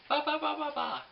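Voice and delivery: high, loud bleating ba ba; llama impression. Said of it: "a good llama impression".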